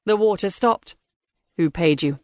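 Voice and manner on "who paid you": cold robotic interrogator. Acted.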